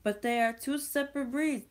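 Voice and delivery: high, loud emphatic reading; over-the-top emphatic reading; dramatic read. Acted. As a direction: announce it like big news.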